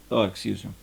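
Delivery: ordinary delivery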